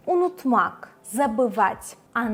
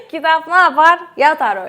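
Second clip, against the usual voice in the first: laughing through a quick question; can't hold back a laugh; amused teacher laughing mid-question; 6 semitones higher, 10 dB louder, faster than usual. Real, not acted.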